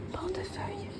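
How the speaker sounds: breathy secret whisper; a whispered word kept from the family. Real, not acted. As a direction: whisper it so he can't hear. Hiding surprise from nearby Clément.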